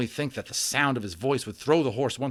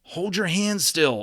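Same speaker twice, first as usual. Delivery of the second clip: slow, loud, higher command; 9 semitones higher, 6 dB louder, slower than usual. Acted. Omri orders Patrick to keep his hands still, firm command. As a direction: order him firmly and slowly. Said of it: "Omri commanded Patrick".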